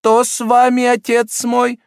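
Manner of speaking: high, loud cardinal voice, feigned concern. A narrator's reading.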